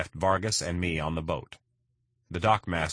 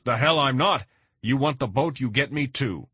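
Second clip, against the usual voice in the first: loud, high, indignant insistence; defiant outburst; 7 semitones higher, 5 dB louder than usual. Acted. Boat owner to McKnight, insisting he comes too, loud and defiant. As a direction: refuse to be left behind, louder on the first words. Vargas insists on coming along.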